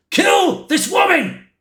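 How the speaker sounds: high, drawn-out cry of command; insistent command. Retold or imitated. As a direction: pitch it right up, stretching the order. Sue repeats her order, insisting.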